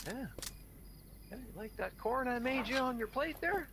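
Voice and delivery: high, loud, overbearing host voice; pushy holiday host. Retold or imitated. Mocking a smug host pushing food on a guest, high and overbearing. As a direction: lay on the hospitality too thick.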